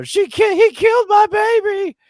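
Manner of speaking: very high, loud mock sobbing wail; mom crying. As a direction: wail it like a heartbroken mom on the phone. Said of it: "She's, like, crying on the phone".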